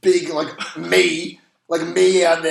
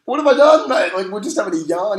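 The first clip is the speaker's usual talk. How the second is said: much higher and louder, mock outraged protest; indignant surprise; 6 semitones higher, 6 dB louder than usual. Fan protesting to the stranger who shushed him, mock indignant. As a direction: play the wronged innocent, pitch flying up. Retelling his shocked reply.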